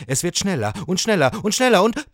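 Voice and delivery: accelerating, rising excitement; speeding up excitedly. A narrator's reading. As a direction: race breathlessly towards a crash. Narrator races along with seahorse.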